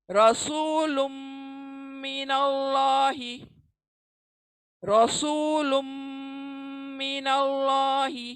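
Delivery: slow chant of रसूलुम मिनल्लाही with heavy रॉ; tajweed recitation. Shown as a demonstration. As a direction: recite slowly, heavy रॉ, merge नून into मीम. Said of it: "रॉ पुर पढ़ेंगे".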